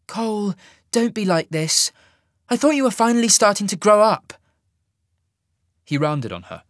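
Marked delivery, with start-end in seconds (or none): 0.1-4.3 exasperated. A narrator's reading. Sasha exasperated plea.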